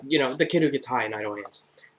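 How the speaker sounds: normal talking voice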